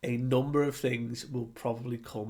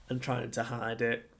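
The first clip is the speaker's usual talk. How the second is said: usual speaking voice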